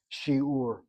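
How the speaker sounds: Shiur said with stress on the first syllable, SHI-ur; dictionary reading. Shown as a demonstration. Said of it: "He spells the word shiur".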